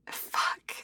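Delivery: breathy whispered swear; whispered curse. Acted. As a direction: curse under your breath. Hungover muttered curse.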